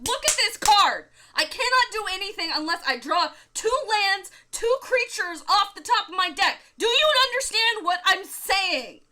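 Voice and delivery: high, loud frustrated rant at partner; exasperated rant. Retold or imitated. Opponent to his partner about his hopeless hand, a loud high rant. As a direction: lose it at your teammate.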